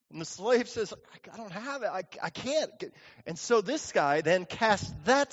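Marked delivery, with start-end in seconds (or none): 0.9-2.7 soft, stammered helpless excuse; small, hushed voice of a debtor; timid plea. Acted. Slave answers his creditor, meek and pleading.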